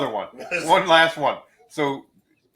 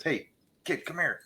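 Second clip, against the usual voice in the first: soft, slightly raised hushed beckoning; hushed call; 4 semitones higher, 7 dB quieter than usual. Acted. Panicking priest to the altar boy, hushed call. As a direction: call someone over on the quiet.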